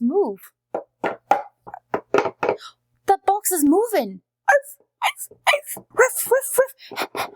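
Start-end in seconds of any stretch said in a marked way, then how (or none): 4.5-7.3 high yappy puppy barks from inside a box; playful high arf arf; dog barks. Acted. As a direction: bark like a small excited dog. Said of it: "Did that box just bark?".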